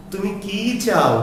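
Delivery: what-question with a long stressed "কি". Shown as a demonstration. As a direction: hold the vowel of "কি", then fall away on "চাও". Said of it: "দীর্ঘ করে উচ্চারণ করতে হবে".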